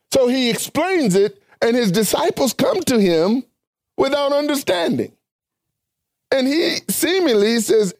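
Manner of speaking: high-pitched, emphatic Bible exposition; preaching. Real, not acted. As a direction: preach it in a high, ringing register, phrase by phrase.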